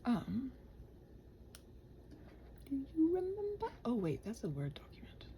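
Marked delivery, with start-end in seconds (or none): none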